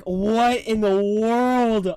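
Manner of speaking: drawn-out astonished; amazed disbelief. Retold or imitated. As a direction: stretch it out in amazement. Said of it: "and it was like".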